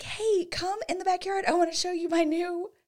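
bright, high, eager call to come see; high eager mock hostess; excited invite. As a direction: show off the new furniture with a lift. Showing off new patio set.

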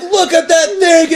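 high, slowed mock awe; mock teen gawking. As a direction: gawk at it, high and drawn out. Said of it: "they're like".

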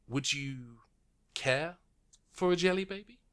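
lightly voiced official, rising to a high lilt. Karai offers jelly baby.

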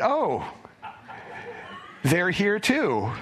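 high, slow mock dismay, with a laugh. Tired bus driver seeing the kids at his house, mock dismay. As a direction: act out a deflated "oh no, they're here". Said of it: "pulled in and went".

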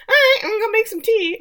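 high, loud and fast, chirpy mock mum; breezy exit. Recapping Millie dodging her daughter, high and breezy. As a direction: toss it off brightly and hurry out.

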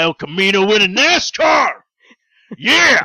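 loud, high hype shout; excited yell. Acted. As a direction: yell it like a race fan cheering. Hyped-up tale, yelling it out.

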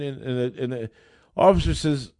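normal talking voice

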